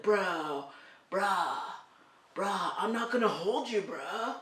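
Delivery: lightly mocking surfer-kid slang voice; surfer-bro teen. Mom imitating how kids today talk, mock surfer-bro voice. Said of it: "very woke surfers? Like Bill and Ted".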